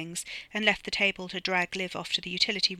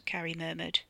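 ordinary delivery

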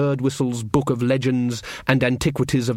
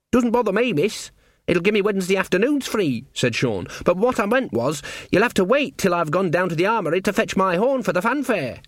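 put-on eager young underling, pitched higher; earnest servant lad; 7 semitones higher than usual. A narrator's reading. Servant insists on fetching horn for fanfare, earnest lad. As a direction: explain it brightly to the mistress.

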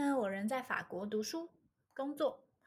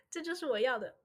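laughing; 2 semitones higher, 4 dB louder, faster than usual. Real, not acted. Playful boast after messy plan.

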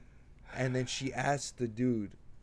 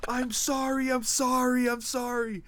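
much higher, quick flustered sorry; flustered apology; 12 semitones higher, 4 dB louder than usual. Retold or imitated. Danny apologizing to the eye-rolling server, high flustered voice. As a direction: squeak out apologies in embarrassment. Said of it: "and she goes".

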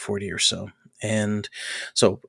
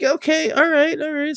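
much higher mock giving-in; baffled acceptance. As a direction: imitate the boss relenting. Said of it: "He's like".

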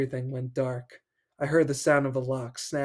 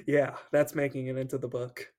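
ordinary talk

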